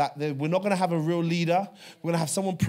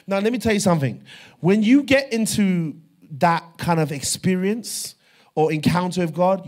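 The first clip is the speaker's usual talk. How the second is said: full-force preaching; 3 semitones higher, 8 dB louder than usual. Real, not acted. Preacher urges congregation about encountering God, full-force preaching.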